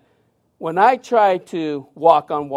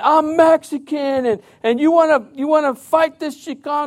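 put-on drunk man challenging; 8 semitones higher, 4 dB louder than usual. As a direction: puff up and dare them. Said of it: "he's cursing".

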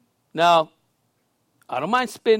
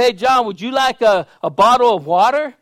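loud, higher retold offer; calling out; 5 semitones higher, 6 dB louder than usual. Pastor offers homeless man water from window, calling out. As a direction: project the question down to someone outside.

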